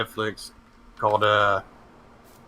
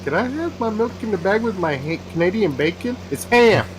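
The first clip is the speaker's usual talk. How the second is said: Canadian correcting ham to Canadian bacon; mock Canadian; 4 semitones higher than usual. Mocking Canadian food talk.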